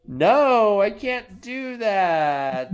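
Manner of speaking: high, slow mock outrage; indignant refusal. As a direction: refuse in hammed-up offence. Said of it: "she's like".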